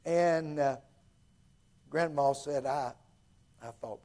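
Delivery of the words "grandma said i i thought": usual speaking voice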